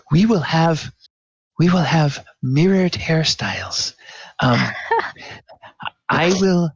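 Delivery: delighted partner planning matching hairstyles; playful Coyote. Acted. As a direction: tease her happily, voice lifted.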